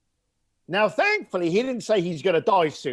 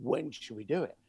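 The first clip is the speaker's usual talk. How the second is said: dropped tone; 5 semitones lower, 8 dB quieter than usual. Shown as a demonstration. Coach shows calming a caller, low and soft. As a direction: lower and softer, calm midnight DJ voice. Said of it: "Drop your tone".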